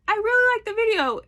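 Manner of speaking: high, fast, sugary fake praise; mimicking a fan texting praise; mock gushing fan. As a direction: gush it like an insincere fan.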